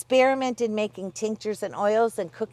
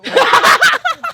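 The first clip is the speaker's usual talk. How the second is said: high burst of laughter; 13 dB louder than usual. Real, not acted. Laughter at the flubbed outro.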